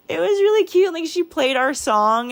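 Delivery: high, emotional squeak; choked up. Real, not acted. Telling how sister played their song, choked up. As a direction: push the words out high through welling tears. Overcome while recalling sister dance.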